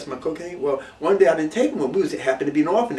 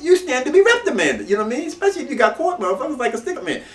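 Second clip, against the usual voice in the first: ordinary talk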